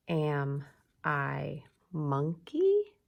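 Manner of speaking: slow, separated words with "monkey" jumping up at the end; rising question. Shown as a demonstration. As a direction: hold "Am I" low, then lift "monkey". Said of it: "so we're asking questions".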